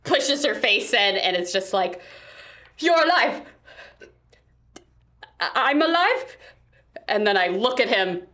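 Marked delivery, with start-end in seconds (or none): 2.8-6.4 excited. Acted. Reunion hug, shocked joy.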